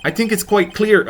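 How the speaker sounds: a hint of a Canadian accent. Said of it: "now Edgeworth is Canadian".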